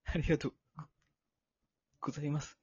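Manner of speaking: soft, fading teary thanks; trembling voice attempt. Shown as a demonstration. Demoing the trembling voice for the ceremony, quietly. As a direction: try a quiet shaky thank-you. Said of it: "できてないやろ".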